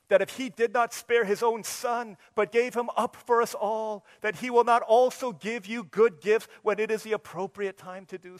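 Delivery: fervent preaching. Acted. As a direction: preach it with fire. Preaching at full force.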